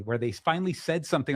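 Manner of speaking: ordinary talk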